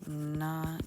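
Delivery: very slow, hushed voice with long pauses; composing aloud while writing. Shown as a demonstration. Teacher drafting an isolation monologue in a journal, slow and hushed. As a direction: murmur it word by word as you write.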